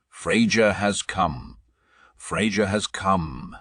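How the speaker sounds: usual speaking voice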